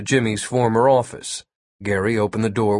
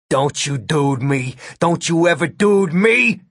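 angry; 4 semitones higher than usual. A narrator's reading. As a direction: snap it back in rising fury. Eddie furious reply.

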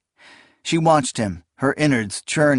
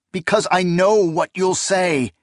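raised, higher-pitched answer; exasperated outburst; heated retort; 8 semitones higher, 4 dB louder than usual. Acted. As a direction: fire back in frustration. Acted quarrel, heated answer.

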